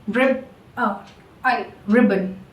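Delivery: ribbon broken into "rib, uh, n", then said whole; unstressed schwa. Shown as a demonstration. Teacher demonstrating the short sound in ribbon, no stress. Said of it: "there is no stress".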